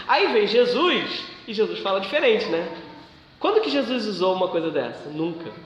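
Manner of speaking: ordinary talk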